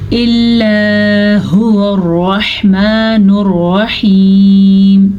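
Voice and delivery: slow, melodic tajweed recitation; Quran recitation. Shown as a demonstration. Teacher reciting a verse for her pupils, slow and chanted.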